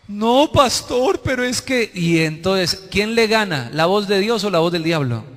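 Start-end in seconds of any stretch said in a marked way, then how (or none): 0.1-1.9 high, drawn-out mock protest; put-on pleading excuse; protesting congregant. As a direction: whine an objection to the pastor. Congregant keeps objecting, acted.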